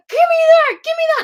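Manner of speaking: loud, high-pitched tantrum yell; temper tantrum. Retold or imitated. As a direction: yell it like a kid demanding it back. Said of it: "like, a temper tantrum".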